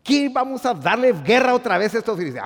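high, mocking challenge; lightly acted Philistine bravado; defiant Philistines. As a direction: taunt like warriors regaining nerve. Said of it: "Los filisteos dijeron".

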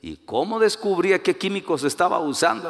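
ordinary talk, nothing marked

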